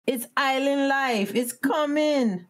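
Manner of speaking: slow, high, unbothered lilt; island lady. Retold or imitated. Island lady soothes frustrated tourists, slow and easygoing. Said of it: "the lady was like".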